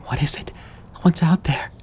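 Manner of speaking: high, tearful whimper; frightened child pleading through tears; cowering plea. A narrator's reading. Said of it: "Amy whimpered through tears".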